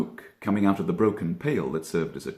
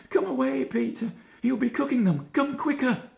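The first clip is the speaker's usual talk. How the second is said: very high, hurried rabbit urging; urgent Benjamin. A narrator's reading. Benjamin urging Peter on up the hill, very high urgent voice. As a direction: pull your cousin along, voice way up. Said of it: "said Benjamin Bunny".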